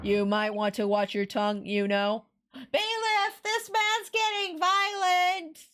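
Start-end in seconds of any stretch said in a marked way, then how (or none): 2.5-5.7 mock distressed call. Calling for bailiff in mock alarm.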